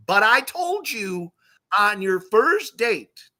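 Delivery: slow, high, drawn-out reproach; emphatic told-you-so. Retold or imitated. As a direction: stretch every word to rub it in. Triumphant told-you-so punchline.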